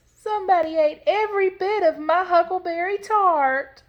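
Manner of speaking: high, loud little bear squeal; Little Honey. A narrator's reading. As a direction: wail it as an outraged tiny cub. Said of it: "in his little bear voice".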